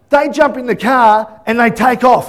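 normal talking voice

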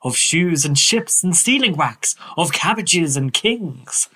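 slow, sing-song poem recital; Lewis Carroll reciting. Acted. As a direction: recite it like a nursery verse. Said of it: "that, uh, performance".

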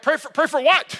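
high-pitched baffled question; incredulous. Retold or imitated. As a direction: act out being thrown by an odd request. Retells his baffled reply to request.

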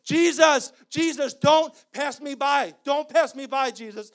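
shouted plea, high and loud; desperate cry. Acted. Blind man shouting to Jesus from the roadside, desperate. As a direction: cry out to Jesus at the top of your voice.

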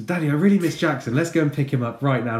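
mock-keen child's plea; eager child. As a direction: gush like a kid angling to leave. Voices son's excited pleading ploy.

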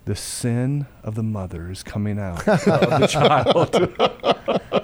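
slow, solemn verdict through laughter; laughing mock pronouncement. Father declares to toddler, slowly and laughing. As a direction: pronounce it like scripture, cracking up. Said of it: "I look at her and I said".

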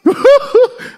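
high, bursting giggle; childlike giggle. Shown as a demonstration. Showing children laugh.

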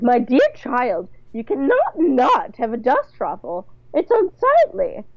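slow, affected scolding with high swoops; condescending mother-in-law correction; haughty matron. Acted. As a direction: draw it out, aghast at bad taste. Bunny scolds Charlotte in acted voice.

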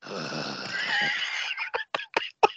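long, low mock commentator breath; imitated heavy breath. Guest commentator at the desk after a pin, heavy mock breathing. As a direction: let out a drawn-out lecherous breath. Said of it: "the only thing you'd hear over the commentator desk".

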